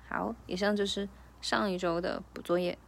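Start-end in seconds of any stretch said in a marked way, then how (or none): none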